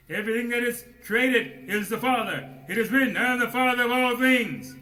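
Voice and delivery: loud, high, slowing on the last words; full-force preaching. Acted. Preacher urging his brethren to fight, loud high preaching. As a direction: preach it up onto the pedestal.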